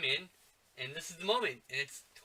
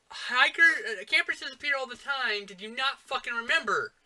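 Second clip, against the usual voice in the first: higher and louder, scolding the characters; exasperated yell; 8 semitones higher, 7 dB louder than usual. Pretend. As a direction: berate them like reckless fools. Keeps yelling at movie characters.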